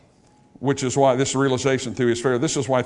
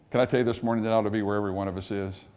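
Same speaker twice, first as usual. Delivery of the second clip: ordinary delivery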